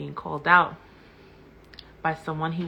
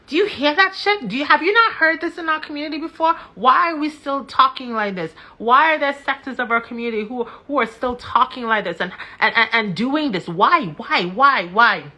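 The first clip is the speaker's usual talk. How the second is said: loud, higher, fast furious shouting; 6 semitones higher, 7 dB louder than usual. Real, not acted. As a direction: hammer every "Why?" at them in real anger. Outraged rant at her audience.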